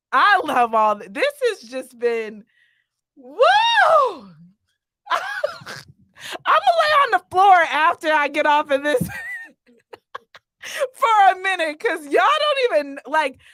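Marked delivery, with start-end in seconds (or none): 3.1-4.2 very high, loud woo; piercing whoop of release; overwhelmed whoop. Real, not acted. Overcome by the poetry. 6.4-13.4 high, loud, laughing exclamation; worked-up outburst broken by laughter; overwhelmed laughter. Real, not acted. Overwhelmed, laughing after the show.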